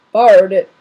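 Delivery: "Borrowed it" said loud in the reader's own pitch; just belt it out, no character; loud but unvoiced as Hagrid; plain loud. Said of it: "How do I do a Hagrid voice?".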